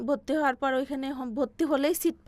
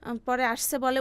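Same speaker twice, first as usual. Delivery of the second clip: normal talking voice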